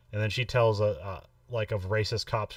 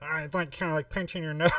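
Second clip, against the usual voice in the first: higher, pinched-nose dork voice; nerd voice; 6 semitones higher than usual. Retold or imitated. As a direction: squeeze it through a pinched nose. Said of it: "He just does a dorky voice".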